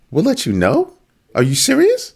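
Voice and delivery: high, disbelieving echo; mock incredulous. Host reacting to a brush-off answer, incredulous. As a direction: throw it back in high-pitched disbelief.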